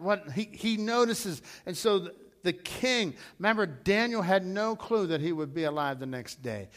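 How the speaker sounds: ordinary talk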